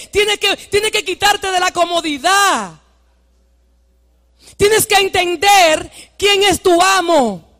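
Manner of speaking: forceful preaching with drawn-out stressed words. Acted. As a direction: drive each exhortation home to the congregation.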